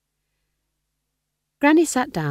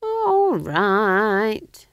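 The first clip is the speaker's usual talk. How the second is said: long, loud, grudging agreement; higher, drawn-out concession; relenting; 7 semitones higher, 7 dB louder than usual. A narrator's reading. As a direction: give in reluctantly, stretching the words. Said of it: "relenting a bit".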